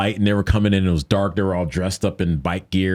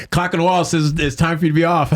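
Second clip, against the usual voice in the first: high, mocking cop voice, clearly higher and put-on; cop's brush-off; 10 semitones higher than usual. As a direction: brush him off like a smug cop. Cop answers him dismissively.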